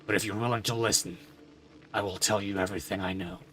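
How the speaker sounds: a weary, grieving father; somber father voice. Acted. Father character speaks again.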